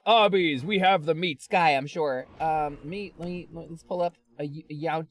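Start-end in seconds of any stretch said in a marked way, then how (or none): 0.0-1.5 low Arby's slogan voice; Arby's ad announcer. Acted. Streamer mimicking the guy from the Arby's ad, low announcer impression. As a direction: drop the pitch like the ad voiceover. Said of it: "It's gonna be that guy".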